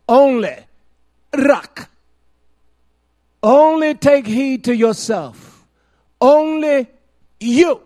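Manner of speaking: loud, high-pitched preaching cadence; preaching at full force. Acted. Preacher to the congregation, at full force.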